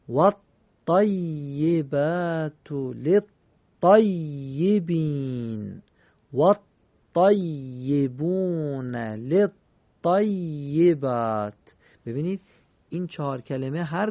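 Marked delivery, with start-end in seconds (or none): 0.9-11.5 loud, slow Quranic recitation of الطیبات للطیبین الطیبون للطیبات, every shadda held; slow recitation with double shaddas. Shown as a demonstration. Teacher reciting verse of Surah Nur, slow and emphatic. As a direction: press each doubled letter. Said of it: "هر کدام دو تا تشدید دارن".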